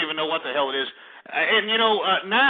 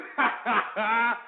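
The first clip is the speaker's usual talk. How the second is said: winded laughing after chugging; breathless laugh; 5 semitones higher than usual. Real, not acted. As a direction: laugh while catching breath.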